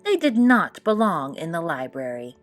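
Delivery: ordinary delivery, nothing marked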